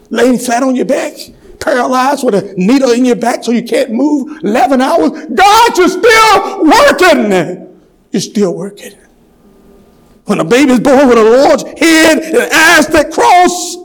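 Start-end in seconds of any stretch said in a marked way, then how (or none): 0.1-5.1 high voice climbing toward a cry; raised pulpit cadence; building preaching. Real, not acted. 5.3-7.8 high, loud, drawn-out preacher's cry; soaring pulpit climax; crying out in praise. Real, not acted. Sermon climax, cries out to God. 10.3-13.8 high, slow, raised pulpit cry; impassioned preacher's shout; full-force preaching. Real, not acted.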